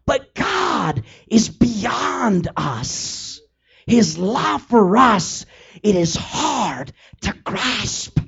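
overwhelmed, near crying over God's love; choked up. Real, not acted. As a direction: voice breaking with tears, slow. Preacher overcome by God's love.

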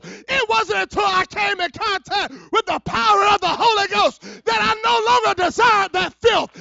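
shouted, high-pitched testimony; full-force preaching. Real, not acted. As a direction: cry out the deliverance at full volume.

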